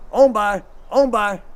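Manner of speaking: loud, firm "On by"; firm command. Shown as a demonstration. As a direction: call the dogs straight past the turn. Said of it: "you say".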